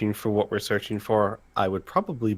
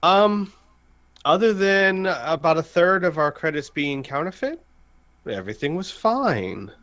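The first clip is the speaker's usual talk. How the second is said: Al answering; 7 semitones higher than usual. Acted. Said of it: "he'll shake his head and he'll say".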